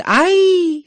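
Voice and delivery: very high, loud mock yelp; on-cue shout. Unmanned thugs shouting on cue, high hammed-up cry. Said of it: "who on cue shouted".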